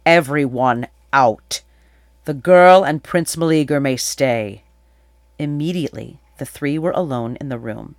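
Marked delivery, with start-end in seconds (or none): 0.1-4.6 raised, controlled royal order; loud, calm proclamation. A narrator's reading. King clears the room of all but two, loud calm proclamation. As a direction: proclaim it firmly to the whole room. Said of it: "With a loud, calm voice, he proclaimed".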